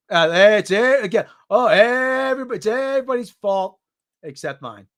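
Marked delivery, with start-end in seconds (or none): none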